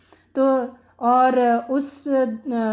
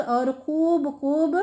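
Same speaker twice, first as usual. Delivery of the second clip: slow and distinct; 3 semitones higher, much slower than usual. Shown as a demonstration. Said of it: "ایک ایک الگ الگ".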